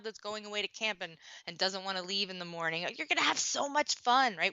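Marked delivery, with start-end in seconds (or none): 2.9-4.3 mock cheery parent. Parent cheering up child before camp.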